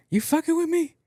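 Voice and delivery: can't believe what he's hearing; high, incredulous yelp; stunned disbelief. Retold or imitated. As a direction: shoot way up high in shock. Sister says she's in labour.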